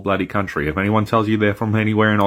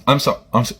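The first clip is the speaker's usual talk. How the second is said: ordinary talk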